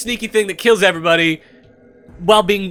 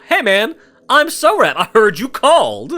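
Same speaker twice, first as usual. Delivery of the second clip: confidence man; 4 semitones higher, 3 dB louder than usual. Acted. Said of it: "I'm gonna go full-on confidence man here".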